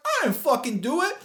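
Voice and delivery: high mock denial; denying. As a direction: protest your innocence in a high voice. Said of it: "I'm like".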